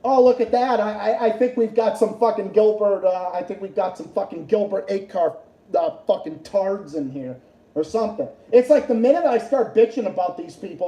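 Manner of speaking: ordinary delivery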